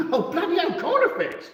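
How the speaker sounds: high, loud burst of laughter; shrieking with laughter; laughing exclaim. Real, not acted. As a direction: exclaim it through a big laugh.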